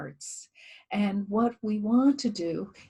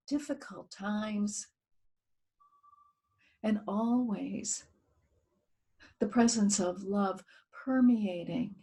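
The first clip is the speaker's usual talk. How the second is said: guided meditation. Acted. Leading listeners in a guided earth meditation, calm and hushed. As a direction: ease listeners inward, letting phrases fade to near whisper.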